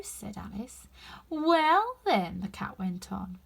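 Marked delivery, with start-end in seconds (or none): 1.3-2.4 slow, drawn-out knowing cat; sly cat taking its time; lazy Cheshire Cat. A narrator's reading. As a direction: savour each word like a grinning cat.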